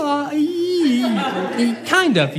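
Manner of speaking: laughing while saying yeah; a high, cracking laugh through the words; laughing agreement. Real, not acted. [name] laughs, grabbing the religion excuse.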